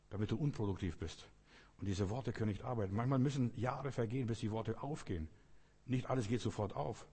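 usual speaking voice

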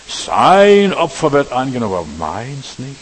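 a loud, slow burst of envy dropping to a sulk; jealous brother complaining about his sacrifice; envious Cain. Acted. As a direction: voice Cain's grudge, then let it sink. Preacher voices envious Cain.

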